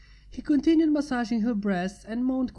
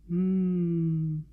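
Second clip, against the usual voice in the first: happy hum; 4 semitones lower than usual. A narrator's reading. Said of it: "she mumbled happily".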